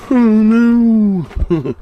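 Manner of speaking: loud, high shocked cry; dismayed cry. Real, not acted. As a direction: blurt out sudden dismay. Finds mess after bumpy drive.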